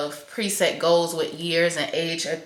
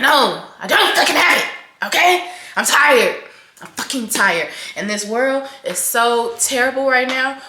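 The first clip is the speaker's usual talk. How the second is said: loud and higher, venting hard; frustrated outburst; 6 semitones higher, 7 dB louder than usual. Real, not acted. Vlogger venting to followers, loud frustrated outburst. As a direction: let the frustration burst out at the viewers. Venting about not having it together.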